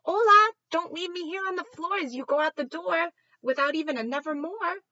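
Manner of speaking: very high, loud squawking plea; squawking parrot. Acted. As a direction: squawk after them in distress. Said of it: "I squawk".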